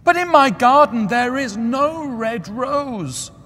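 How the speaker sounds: put-on high, loud, slow lament of the young student; the student wailing his loss; despairing student. A narrator's reading. Student voiced in story.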